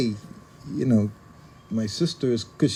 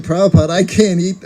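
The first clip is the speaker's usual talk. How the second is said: loud protest; 7 semitones higher, 8 dB louder than usual. Retold or imitated. Servant protesting to guru.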